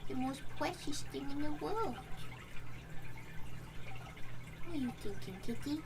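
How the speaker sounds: high cooing pet voice. Real, not acted. Cooing over the cat's face.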